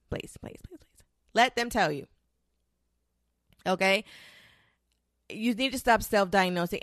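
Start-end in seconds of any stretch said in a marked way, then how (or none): 0.1-1.0 breathy, begging whisper; whispered pleading. Real, not acted. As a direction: plead under your breath. Pleading repeatedly.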